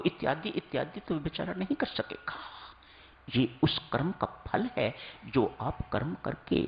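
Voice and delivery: ordinary talk, nothing marked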